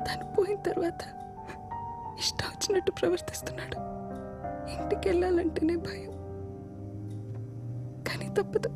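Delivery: hushed, half-whispered confession with long pauses; pained confiding. Acted. Woman confiding abuse at home to the man, pained and half-whispered. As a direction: confide it haltingly, voice breaking into whisper.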